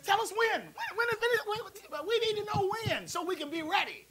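high, pleading, laughing mimic; hammed-up high whiny plea; anxious disciples. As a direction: beg like an impatient disciple. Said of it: "He says, he says".

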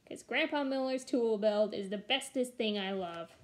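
ordinary delivery

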